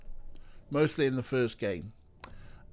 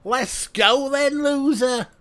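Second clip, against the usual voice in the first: loud, high, drawn-out fake Scouse; bad Scouse accent; 9 dB louder, slower than usual. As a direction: call out in hammed-up bad Scouse. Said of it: "I'm doing a bad Scouse".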